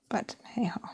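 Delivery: whispered to herself. Real, not acted. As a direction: breathe it out in a whisper. Whispered while colouring.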